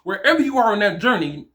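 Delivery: ordinary talk, nothing marked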